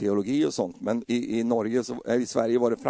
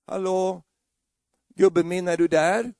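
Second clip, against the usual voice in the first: wife calling out; 4 semitones higher, 3 dB louder, slower than usual. Retold or imitated. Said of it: "hon ropar på dig så här".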